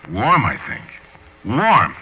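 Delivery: disbelieving echo of warm; incredulous repeat. Acted. Floyd echoing the word in shock.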